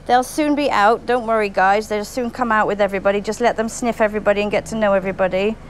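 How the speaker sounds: usual speaking voice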